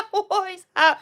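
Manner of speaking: high, loud burst of laughter running into the words; real laughter. Real, not acted. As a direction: crack up, still laughing as you ask. Bursts out laughing at a chat message.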